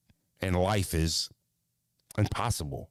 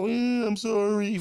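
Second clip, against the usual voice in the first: put-on high, slow sniffly child voice; whiny crying child; 11 semitones higher, 4 dB louder, slower than usual. A child apologizing to his father, high and whimpering. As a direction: whimper it like a sad kid.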